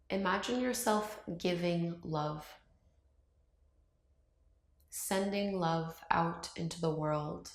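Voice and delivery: calm meditation voice with long, unhurried pauses; guided meditation. Acted. Yoga teacher guiding viewers to open up to love, calm meditation voice. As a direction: ease them through each breath, leaving silence between phrases.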